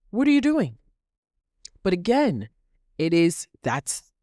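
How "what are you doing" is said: much higher, incredulous scold; exasperated. Real, not acted. Speaker to jealous women blocking others, exasperated. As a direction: demand an answer, fed up.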